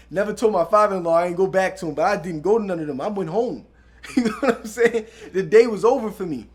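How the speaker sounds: chuckling through the words; laughing. Real, not acted. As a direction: laugh while telling it. Laughing at own story.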